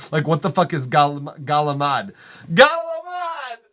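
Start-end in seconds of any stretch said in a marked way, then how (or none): 2.5-3.6 high, drawn-out mock cry; mocking dialect shout. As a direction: ridicule the word with a long high yell.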